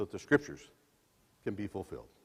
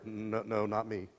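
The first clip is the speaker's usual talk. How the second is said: fearful denial; 4 semitones lower, 7 dB quieter than usual. Acted. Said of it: "He responds out of fear".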